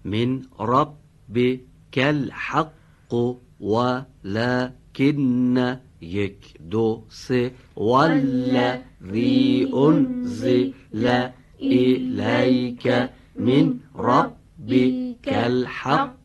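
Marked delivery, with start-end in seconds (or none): 0.1-5.8 drawn-out chanted Arabic; slow Quran recitation. Shown as a demonstration. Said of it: "من از من ربک میخونم". 7.8-16.1 slow chanted Arabic verse; Quran recitation. Shown as a demonstration. Teacher models recitation.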